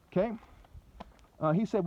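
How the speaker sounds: ordinary talk, nothing marked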